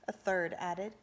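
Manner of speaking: plain reading voice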